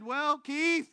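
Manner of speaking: fed-up inner voice reasoning with him; voice in his head. Retold or imitated. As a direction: let his own head talk him into it. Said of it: "but then my head said".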